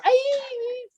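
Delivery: put-on very high squealed Valley Girl "Hi!"; Valley Girl accent. Shown as a demonstration. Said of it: "Ben's doing the impression for you".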